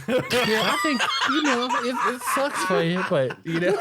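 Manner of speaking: high voice bubbling with laughter; mock sympathy through giggles; laughing retelling. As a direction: crack up while imitating awkward consolation. Laughs through retold consolation.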